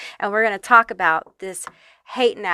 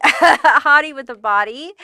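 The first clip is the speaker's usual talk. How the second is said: louder, higher, amused burst; laughing; 5 semitones higher, 7 dB louder than usual. Real, not acted. Reader amused after fumbling the card's wording, laughing. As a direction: laugh your way into the phrase. Cracks up at own tongue slip.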